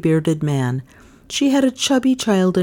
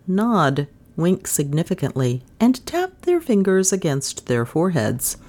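ordinary delivery, nothing marked

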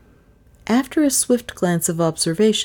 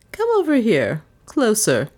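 higher, commanding summons; imperious; 5 semitones higher than usual. A narrator's reading. Mistress ordering the gardener closer, imperious. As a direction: order him closer like a queen. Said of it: "said Miss Cornelia imperiously".

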